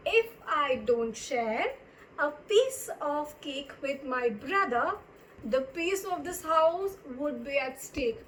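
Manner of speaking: slow, deliberate teacher's dictation; drawn-out words with pauses between phrases; slow example reading. Shown as a demonstration. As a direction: read it out phrase by phrase for learners. Example sentence dictated to learners.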